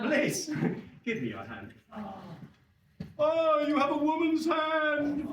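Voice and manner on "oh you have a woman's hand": high, drawn-out comic exclamation; British TV catchphrase. Acted. Comedian taking an audience member's hand, high catchphrase voice. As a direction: put on a high, slow sitcom-style voice. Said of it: "Maybe British T V somewhere".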